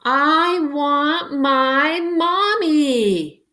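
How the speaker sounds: high, slow, drawn-out wail. Acted. Baby in space crying for his mother, high slow wail. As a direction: whimper it like a frightened toddler. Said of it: "wailed baby Brains".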